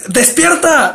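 high mock yell of wake up. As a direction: bark it like shaking someone awake, half-hearted. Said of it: "te grita".

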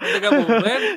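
high, laughing through the words. Real, not acted. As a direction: laugh as you say it. Laughs at the name's origin.

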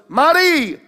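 high, loud shouted call in Italian; retold father's yell. Father yelling for the mother to bring a towel, loud. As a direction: bellow it across the house like an impatient dad. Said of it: "He yells out".

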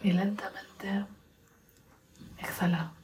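soft, unhurried meditation voice with long pauses; guided meditation. Acted.

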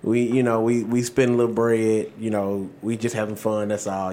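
low, soft laid-back impression of Carmelo; a mumbled, chill Carmelo voice; imitating Carmelo. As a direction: drop low and quiet, easygoing and unbothered. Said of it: "Mello like".

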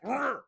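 high loud playful growl; a cute little feral snarl; feral grunt. Retold or imitated. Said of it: "That little feral grunt".

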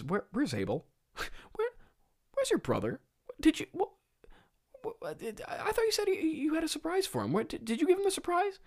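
high, whispery, feigned innocence; innocent God. Retold or imitated. God pretending not to know where Abel is, mock innocent high voice. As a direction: play dumb in a breathy high voice. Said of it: "God approaches Cain, and he says".

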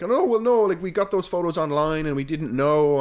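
high, loud imitation of someone making excuses; mock protest. Company making excuses about photos found online, mock protest.

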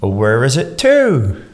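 slow, high, put-on Bristolian with a stretched TO; Bristolian accent. Scottish guest copies the host's demo, mock Bristolian accent. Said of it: "I just kinda copied yours".

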